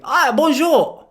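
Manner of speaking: high, drawn-out French greeting; mock French accent. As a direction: greet a child in hammed-up French. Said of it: "going up to a little French kid".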